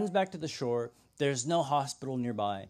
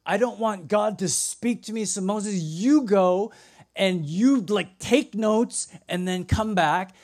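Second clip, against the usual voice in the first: higher, louder mock pleading; alarmed refusal; 6 semitones higher, 7 dB louder than usual. People to Moses, afraid of God speaking, acted alarmed refusal. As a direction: push the job urgently onto someone else.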